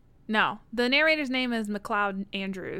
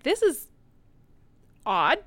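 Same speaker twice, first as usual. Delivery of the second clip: incredulous; 3 semitones higher, 5 dB louder than usual. Retold or imitated. Reacting to narrator's accent.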